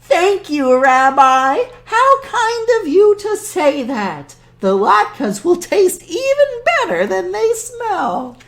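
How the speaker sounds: put-on high, sweet old woman; cheerful high granny voice; old grandmother voice. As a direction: play the kindly old granny talking to her guest. Voices Baba Brena throughout.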